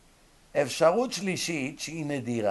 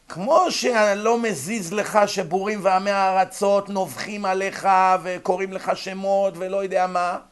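forceful preaching; 7 semitones higher, 7 dB louder than usual. Real, not acted. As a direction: drive the point home to listeners.

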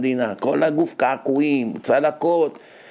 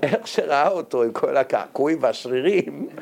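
ordinary delivery

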